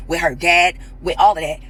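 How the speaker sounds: ordinary talk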